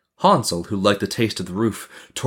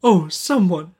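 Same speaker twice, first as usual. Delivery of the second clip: put-on high, plaintive little-girl plea; pleading cry; 11 semitones higher, slower than usual. A narrator's reading. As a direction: cry for help as a frightened girl. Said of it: "she cried".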